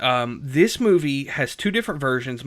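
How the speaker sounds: ordinary talk, nothing marked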